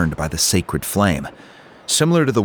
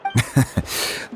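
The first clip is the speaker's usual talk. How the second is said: a short breathy chuckle; quiet amused exhale; soft laugh; 4 semitones higher, 4 dB quieter than usual. Acted. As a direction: laugh it off gently before answering. Chief laughs before answering.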